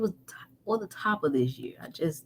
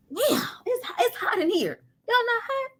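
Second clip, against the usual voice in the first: very high-pitched comic complaint; hammed-up high voice of someone overheating; acting too hot. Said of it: "when you get too hot".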